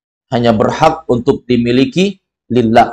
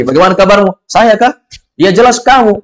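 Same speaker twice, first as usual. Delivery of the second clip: acted dialogue; 7 semitones higher than usual. Acted confused exchange.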